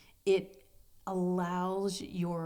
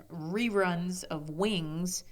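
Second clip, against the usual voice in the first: ordinary delivery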